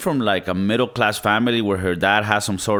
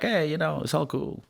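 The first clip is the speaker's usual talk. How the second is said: higher-pitched mock nonchalance; casual retold brush-off, trailing softer; playing it off; 6 semitones higher, 7 dB quieter than usual. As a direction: act like it's no big deal. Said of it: "tried to play it off".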